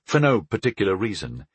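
ordinary delivery

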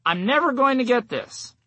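hammed-up frustration with yourself; mock exasperation. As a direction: complain as if you'll never manage it. Voicing negative self-talk with universal words.

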